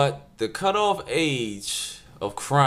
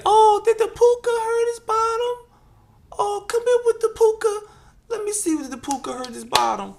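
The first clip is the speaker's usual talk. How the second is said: coddling mother; 5 dB louder than usual. Acted. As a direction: coo over a hurt toddler in a squeaky high voice. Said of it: "they say".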